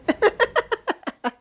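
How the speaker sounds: short high-pitched giggle; a quick bright giggle. Real, not acted. Laughing at own joke.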